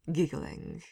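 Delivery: usual speaking voice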